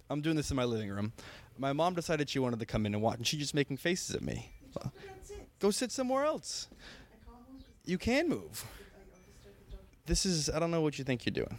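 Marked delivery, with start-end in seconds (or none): 5.6-8.6 high exasperated shoo. Real, not acted. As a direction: wave her off, fed up. Shooing his mom away.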